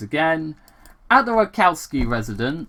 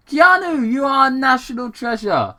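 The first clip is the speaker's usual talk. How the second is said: high, loud starstruck praise; gushing fan; 12 semitones higher, 6 dB louder than usual. Acted. Sonic praising Keanu on screen, high and loud. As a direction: worship him with your voice.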